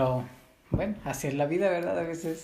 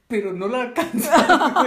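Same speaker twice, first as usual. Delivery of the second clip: higher, laughing as she speaks; giggling through the line; 6 semitones higher, 4 dB louder than usual. Real, not acted. Joking about the door opening, laughing.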